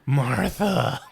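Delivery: strained, whispery mock plea; hammed-up breathless movie impression; choked dying Superman. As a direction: gasp it out like a dying hero. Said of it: "He's like".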